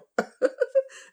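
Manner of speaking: a high, bright burst of laughter. Real, not acted. Joking to viewers about not editing, a real high laugh. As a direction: laugh at your own unedited honesty.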